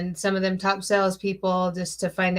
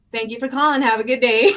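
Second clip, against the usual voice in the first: higher, louder, chirpy phone sign-off; mock customer-service politeness; 3 semitones higher, 7 dB louder, faster than usual. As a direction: sweetly dismiss a rude caller. Polite sign-off to rude caller.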